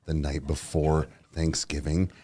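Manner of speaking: low, soft hushed voice; hushed storytelling. Real, not acted. Confessing a shroom story quietly to the mic. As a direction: tell it under your breath. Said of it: "we'll talk quietly".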